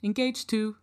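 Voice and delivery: polite robot. Acted. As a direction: answer like a helpful but clueless robot. Robot reports record.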